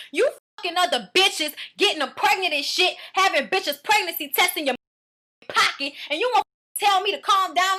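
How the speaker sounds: going off on him, high and loud; angry rant. Retold or imitated. Acting out her going off on him.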